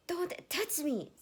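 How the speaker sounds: high, slow, loud shriek. Acted. Erica shrieking at Ned after he touches her. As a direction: scream it in outrage. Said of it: "she shrieked".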